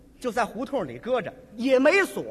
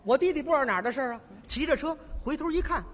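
ordinary talk

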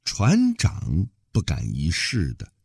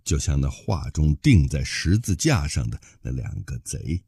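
plain reading voice

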